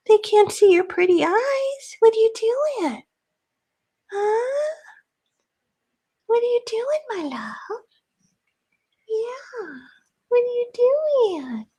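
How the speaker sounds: squeaky high pet voice to the cat. Real, not acted. Crafter cooing to her cat in a high pet voice.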